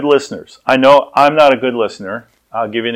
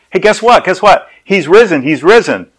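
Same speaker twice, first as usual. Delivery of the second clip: high, breathless-with-news exclamations, louder; excited news; 7 semitones higher, 4 dB louder than usual. Acted. Women bursting with news for the apostles, excited. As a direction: announce it eagerly, voice pitched up.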